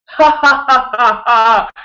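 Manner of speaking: put-on high, loud kid's laughter; the grumpy son finally bursts out laughing; imitated child laugh. Four-year-old giving in and laughing, high loud laugh. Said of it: "Malachi is like".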